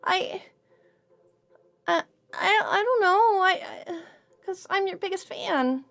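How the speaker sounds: a cheerful fae who panics when caught; Suki, pleading. Acted. GM voices Suki throughout.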